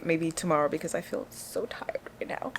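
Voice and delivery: soft, unvoiced bedtime whisper; sleepy whispered sign-off; tired whisper. Real, not acted. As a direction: whisper it as if too tired to speak.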